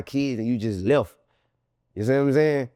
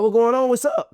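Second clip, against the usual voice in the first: higher and louder call across the lot; 10 semitones higher, 5 dB louder than usual. Retold or imitated. Calling to the men from the door, a high loud call. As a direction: shout it over to the guys by the tunnel.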